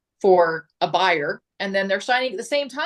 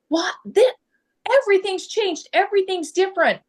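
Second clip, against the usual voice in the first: much higher, amused astonished client; mock surprise; 11 semitones higher than usual. Agent imitating a client amazed at new commission rules, high and laughing. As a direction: toss it up high like she can't believe it. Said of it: "she kinda laughed about it".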